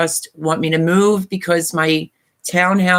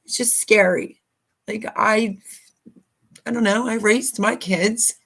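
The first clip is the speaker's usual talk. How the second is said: higher, halting voice close to tears; real choked-up apology, softer and hesitant; 4 semitones higher, 3 dB quieter than usual. Real, not acted. Vlogger apologizing to viewers over losing her home, choked up. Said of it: "getting all sappy".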